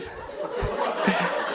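a short real breathy laugh; airy laugh. Real, not acted. Pastor laughing again mid-sentence, breathy.

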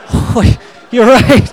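high, very loud mock astonishment; hammed-up shocked admission; stunned realization. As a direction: burst out as if floored by the truth. Acting out sudden comic realization.

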